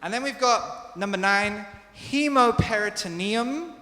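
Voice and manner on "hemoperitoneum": slow hemoperitoneum as "hee-mo-per-i-to-NEE-um"; model pronunciation. Shown as a demonstration. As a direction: carefully show hemoperitoneum. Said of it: "difícil".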